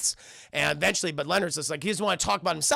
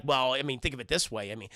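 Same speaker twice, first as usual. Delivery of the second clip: low, even, measured explaining; mock-nerdy lecturing, pitched low; math speak; 5 semitones lower, 3 dB quieter than usual. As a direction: reason it out like a dry calculation. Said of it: "he starts doing math speak".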